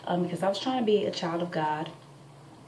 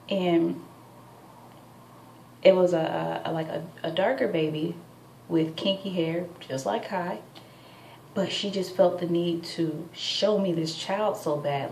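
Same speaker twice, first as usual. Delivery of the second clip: ordinary delivery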